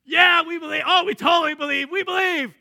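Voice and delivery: preacher mimicking the crowd's bravado; mocking crowd. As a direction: ham up the empty cheering. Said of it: "The crowd that said they believed".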